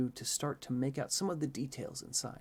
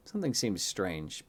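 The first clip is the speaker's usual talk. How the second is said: ordinary delivery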